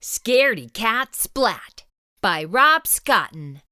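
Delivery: usual speaking voice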